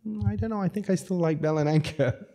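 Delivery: high-pitched, hesitant mock reply; doubtful reluctance. Voices Bettina's doubtful reply.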